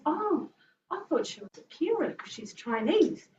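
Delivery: a hint of a surprised old lady; mock neighbour. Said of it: "And she goes".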